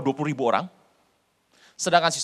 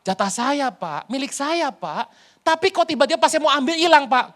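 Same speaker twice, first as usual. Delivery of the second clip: mock complaint; 8 semitones higher, 6 dB louder than usual. Complaining man losing his due.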